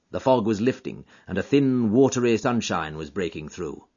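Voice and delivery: usual speaking voice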